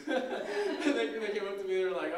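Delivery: amused, laughing as you recount it. Real, not acted. Laughing while retelling a funny mistake.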